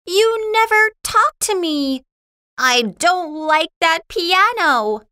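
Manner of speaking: put-on high, indignant girl's complaint; angry Lucy. Lucy scolds Schroeder in the story.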